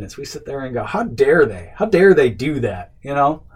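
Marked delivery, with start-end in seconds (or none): 0.8-2.9 louder, mock-offended outburst; mock indignation. Man stewing over a rude caller, mock indignant. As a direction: act out anger creeping in, mildly outraged. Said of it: "that's how anger creeps in".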